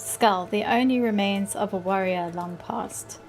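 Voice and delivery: normal talking voice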